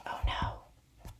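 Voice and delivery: hushed breathy whisper. Acted. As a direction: sneak out whispering so no one wakes. Sneaking outside after tantrum.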